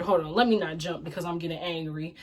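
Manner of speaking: ordinary talk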